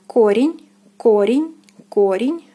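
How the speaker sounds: корень with full first "o"; careful model корень, weight on о; stressed O. Shown as a demonstration. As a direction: say корень as "KO-ryen'", rising. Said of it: "O is stressed".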